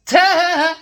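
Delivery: loud, high burst of laughter; amused laugh. Real, not acted. Laughs about cussing him.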